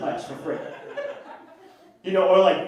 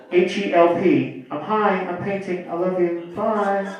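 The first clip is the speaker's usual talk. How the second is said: slow spelling into singsong sign-off; mock voicemail goodbye; slower than usual. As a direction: lightly imitate a campy caller signing off. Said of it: "My cousin calls me every week".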